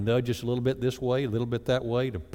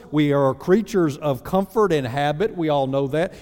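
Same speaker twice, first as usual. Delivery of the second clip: normal talking voice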